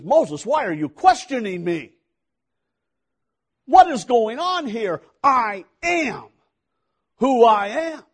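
loud, high, forceful challenge; God rebuking Moses. Acted. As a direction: thunder it as God confronting Moses. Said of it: "The underlying thing is".

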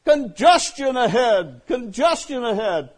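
the GPS lady yelling a warning. Retold or imitated. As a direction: shout it like a nagging sat-nav. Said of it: "she's yelling at me".

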